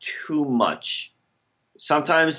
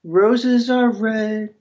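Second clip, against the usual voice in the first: slow sing-song recital; mock poem; 6 semitones higher, slower than usual. Co-host mocking the host with a slow rhyme.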